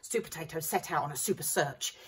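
usual speaking voice

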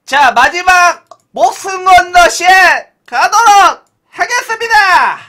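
high, loud, slow countdown; final hype yell. Real, not acted. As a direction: go all-in with the last count.

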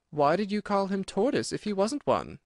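high, questioning voice; curious Alice. A narrator's reading. Alice questioning the Mock Turtle, high and curious. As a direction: ask with innocent puzzlement.

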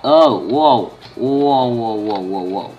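loud startled whoa, fading down. Real, not acted. Gamer startled by a sudden drop, loud whoa. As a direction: caught off guard, then calming. Falling into a cave while gaming.

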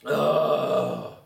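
long throaty ugh; exasperated groan. Real, not acted. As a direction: let out a groan of disgust. Exasperated noise mid-sermon.

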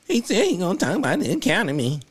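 high mocking parody of a put-on accent; mocking Kamala's fake accent. Mocking the candidate's speech clip in a high fake accent. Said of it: "Here she go with that fake accent".

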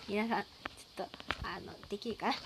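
ordinary talk, nothing marked

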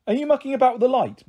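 calling out from the next room; calling from another room. A narrator's reading. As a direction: mum calling out to her daughter. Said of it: "Mum called from the living room".

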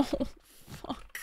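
cursing in a whisper; hushed frustrated curse. Real, not acted. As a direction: breathe the swear out. Frustrated aside under breath.

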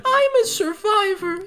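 squeaky high voice for Keys; mimicking Keys. Mimicking Keys insisting he's a survivor, squeaky high. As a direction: pipe it up high and silly. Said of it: "says Keys".

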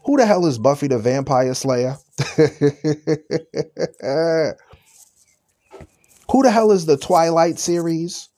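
ordinary delivery

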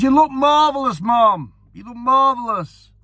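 very high, loud call of praise; retold shout. Racegoer hailing the Queen Mother at Cheltenham, high excited shout. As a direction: shout the compliment across the crowd. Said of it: "I shouted".

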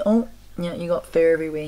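normal talking voice

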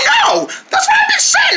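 very high laughing shout; excited squeal. Acted. Friend agreeing about seatbelts with a shrieking laugh. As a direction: squeal with delight at being right.

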